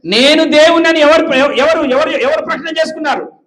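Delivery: forceful preacher's demand; loud emphatic question. Real, not acted. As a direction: hammer the question at the crowd. Preacher pressing the congregation.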